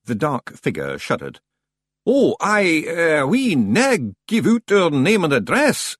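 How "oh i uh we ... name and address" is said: high, loud, faltering Scots; evasive Scots stranger. A narrator's reading. Stranger refusing librarian his address, high faltering Scots. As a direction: stall nervously in broad high Scots. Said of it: "it said quickly".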